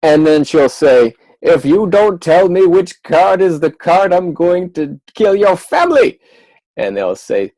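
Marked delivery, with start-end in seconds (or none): 1.4-6.2 a hammed-up royal threat; queen voice. Magician voicing the queen threatening the deck.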